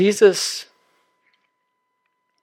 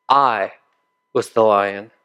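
a hint of a grave, lower Aslan, slow and weighty; solemn lion voice; 5 semitones lower than usual. The lion's voice reveals itself.

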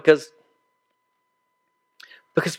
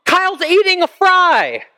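very high, loud cry for Mom; hammed-up high-pitched child's holler; tattling kid's yell; 14 semitones higher, 4 dB louder, slower than usual. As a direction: imitate a boy yelling to tattle on his friend. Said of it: "I hear my friend yell out".

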